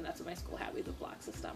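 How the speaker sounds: whispered under the breath as an aside; a quick whispered afterthought. Real, not acted. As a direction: drop to a whisper for the repeat. Muttered aside repeating the phrase.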